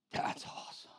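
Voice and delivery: whispered awe. Retold or imitated. Voicing the lucky worker.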